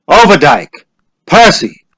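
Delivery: loud roll call; name called out loud. A narrator's reading. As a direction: call the name across the crowd. Calling names.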